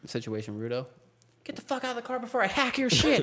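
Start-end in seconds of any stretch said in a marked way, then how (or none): 1.4-3.2 high-pitched mock threat to a driver; hammed-up cop ordering someone out; mock police command. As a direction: playfully overdo an urgent order. Jokingly acts out police command.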